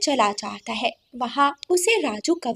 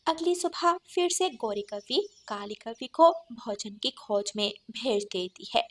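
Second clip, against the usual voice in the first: ordinary delivery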